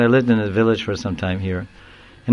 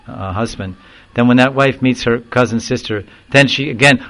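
normal talking voice

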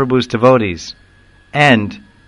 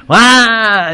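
sudden high, loud mock wail; imitated wail; 11 semitones higher, 5 dB louder than usual. Telling of village widows, mimicking the widow's burst of crying. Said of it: "then the crying goes up".

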